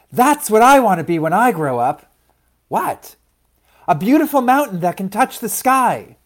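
high, loud, thrilled seed; bursting child-like excitement; excited declaration. A narrator's reading. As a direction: proclaim a big dream in a small high voice. Louise announces her dream.

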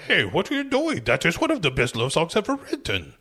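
high-pitched, hammed-up orc; Nick the Orc. Orc objecting as his partner shuts off the radio, high put-on voice. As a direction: protest in a high put-on creature voice. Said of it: "Nick the Orc is like".

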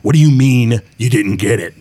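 gruff boss demanding answers; loud gruff voice. Acted. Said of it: "a loud, gruff voice".

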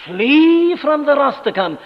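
loud, high pulpit appeal; impassioned preaching. Acted. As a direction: plead with the congregation to flee. Preaching at full force.

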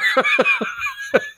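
high-pitched burst of laughter. Real, not acted. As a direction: let out a squealing laugh. Big laugh after quote.

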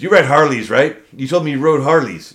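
mock drill instructor to a recruit. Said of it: "He goes".

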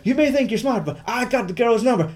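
high, gloating movie-hero taunt; smug taunt. Retold or imitated. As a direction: rub it in his face, grinning. Acts Matt Damon's smug diner taunt.

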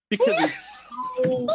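breaking into high laughter mid-word; laughing. Real, not acted. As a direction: laugh through the words. Laughing at a bad movie.

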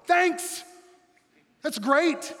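loud, high mock "thanks"; shouted ironic gratitude; sarcastic thanks. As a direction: throw the thanks up with bitter sarcasm. Sarcastic thanks after hip injury.

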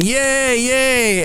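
high, bright little cheers; hammed-up crowd cheer; mock cheering. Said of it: "everybody's cheering".

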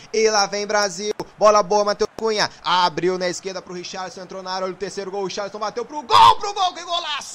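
rising frantic play-by-play yell; explosive goal commentary. Real, not acted. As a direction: build from excited call to screaming goal. Goal build-up, rising to scream.

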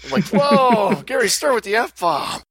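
laughing shout of disbelief. Real, not acted. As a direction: blurt it out through laughter. Laughing at quote.

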